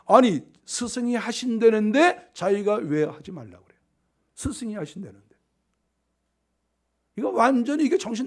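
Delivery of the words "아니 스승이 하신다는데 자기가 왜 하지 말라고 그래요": ordinary delivery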